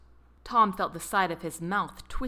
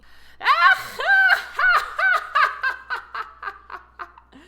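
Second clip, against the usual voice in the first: loud, very high shrieking laughter; wild laughing; 10 dB louder than usual. A narrator's reading. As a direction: let the laugh explode, wild and long. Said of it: "Detective Ross laughing wildly".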